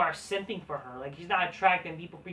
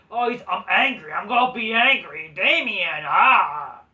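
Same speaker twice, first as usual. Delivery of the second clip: hammed-up louder angry growl, ending in a loud ah; mock an angry wrestler's alter ego, louder; mock angry Damian; 8 dB louder than usual. Mocking Damian Priest's alter ego to viewers, hammed-up louder anger.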